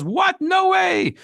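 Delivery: king shocked to hear Haman is the villain; stunned disbelief. Acted. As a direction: jump up in pitch in sheer disbelief.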